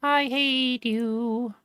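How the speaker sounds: very high, slow cartoonish whine; squeaky whiny voice. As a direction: whine it way up high, dragging each word. Mock whiny complaint at chat.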